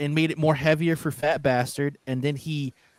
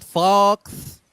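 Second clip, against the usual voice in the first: British accent; 6 semitones higher, 5 dB louder than usual. Shown as a demonstration. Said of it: "that's how they pronounce Fox".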